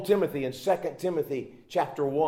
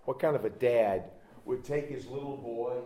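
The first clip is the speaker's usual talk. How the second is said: ordinary talk, nothing marked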